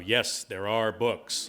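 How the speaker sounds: drawn out slowly, each word weighed; slow proud motto. Retold or imitated. Telling the publisher the tour motto, slowly and proudly. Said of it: "that was going to be our motto".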